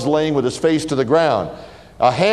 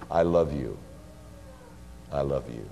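softer and lower, hushed; tender; 10 semitones lower, 12 dB quieter than usual. Acted. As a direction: breathe it out with deep love. God's words of love.

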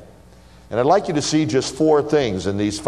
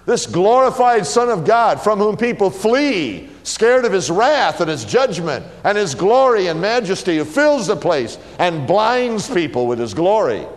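fervent; 7 semitones higher than usual. Real, not acted. Preacher building fervor.

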